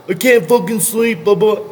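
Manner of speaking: mock whiner. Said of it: "that person that's like".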